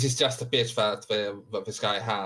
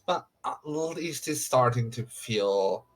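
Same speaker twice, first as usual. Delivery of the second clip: ordinary talk, nothing marked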